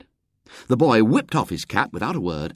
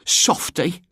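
put-on high girl's sneer; scornful; 11 semitones higher than usual. A narrator's reading. Eglantine commenting on the man, scornful. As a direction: dismiss him with high-voiced contempt. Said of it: "commented Eglantine scornfully".

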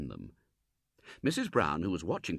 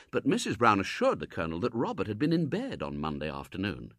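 ordinary delivery, nothing marked

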